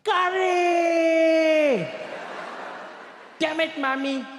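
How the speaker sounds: long, high held scream of outrage; bellowed high-pitched exasperation; top-of-lungs scream. Acted. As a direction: scream it sky-high, drag out the first word. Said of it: "he'd scream at the top of his lungs".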